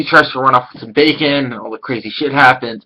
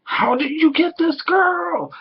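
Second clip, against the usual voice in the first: high, slow, disbelieving guys; jealous classmates; 13 semitones higher, 4 dB quieter than usual. Retold or imitated. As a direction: drag it out in envious disbelief. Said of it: "looking at him like".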